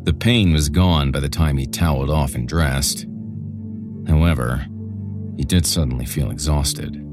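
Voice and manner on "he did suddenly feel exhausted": plain reading voice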